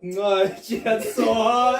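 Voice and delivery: broad Geordie, loud and laughing; Geordie accent. Retold or imitated. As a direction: slip back into Geordie. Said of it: "Bro, I was like, 'cause this is how I talk".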